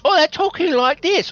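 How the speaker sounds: very high put-on drama voice; Masterpiece Theater voice. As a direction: mimic a TV theater actor way up high. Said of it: "Masterpiece Theater".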